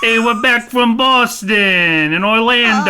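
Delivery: put-on loud, broad Boston accent; hammed-up Boston drawl. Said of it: "I end up with my Boston accent again".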